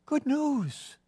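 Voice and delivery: high, soft call trailing off; fading call. Acted. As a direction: call it as he runs away. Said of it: "You could hear him across the camp".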